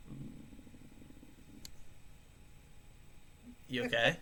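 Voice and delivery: soft, laughing fake concern; mock concern. Friend imitated checking on crying girlfriend, softly amused. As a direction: coo it with a smirk. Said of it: "I'd be like".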